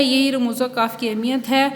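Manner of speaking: ordinary delivery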